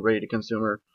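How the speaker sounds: plain reading voice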